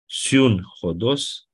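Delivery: Siun Hodos spelled out very slowly, syllable by syllable; slow Greek demo. Shown as a demonstration. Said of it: "împreună cale".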